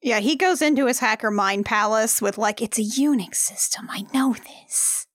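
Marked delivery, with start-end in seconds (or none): 2.6-5.0 hushed, whispery imitation of a film line; whispered movie quote. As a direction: whisper it like a dramatic realization.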